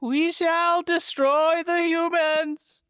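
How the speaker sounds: very high, very slow pronouncement; high demon voice. Retold or imitated. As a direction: proclaim it like a squeaky demon. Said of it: "grand pronouncements".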